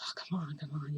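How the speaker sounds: very soft, near-whispered plea; hushed coaxing. Retold or imitated. Toshinori urges her while visitor waits outside, hushed coaxing. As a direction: coax under your breath while hiding.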